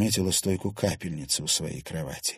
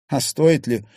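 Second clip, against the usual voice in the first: sudden challenge; 6 semitones higher, 8 dB louder than usual. A narrator's reading. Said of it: "внезапно спросил парень".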